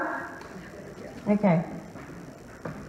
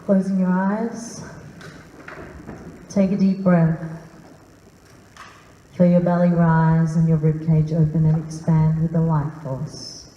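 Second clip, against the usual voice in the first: guided meditation; 6 semitones lower than usual. Acted. Guiding the room through deep breathing, low and calm.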